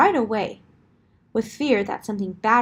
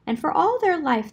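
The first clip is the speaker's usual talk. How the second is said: usual speaking voice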